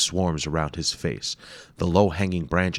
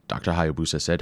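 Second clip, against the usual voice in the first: ordinary delivery, nothing marked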